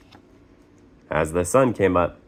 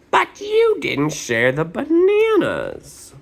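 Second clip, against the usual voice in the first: squeaky character voice; 3 dB louder than usual. Acted. Character complaining in a story.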